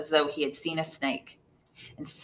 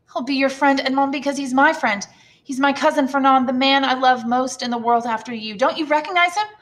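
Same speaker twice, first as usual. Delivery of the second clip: put-on higher, bright young woman; voiced Mercedes; 7 semitones higher, 5 dB louder than usual. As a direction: introduce the cousin brightly.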